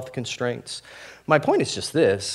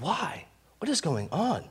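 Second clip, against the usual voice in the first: high, soft plea with swooping pitch; acted-out puzzled cry, kept quiet; bewildered questioning; 8 semitones higher, 6 dB quieter than usual. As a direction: ask it softly, voice leaping up in bewilderment. Voices bewildered believers' questioning.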